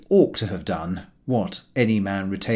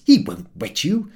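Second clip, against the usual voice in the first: a raised, brisk character voice for Harris; Harris voiced; 6 semitones higher, 4 dB louder than usual. Harris dismissing George's fear of the hose, in a raised brisk voice. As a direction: toss it off, sure nothing will happen.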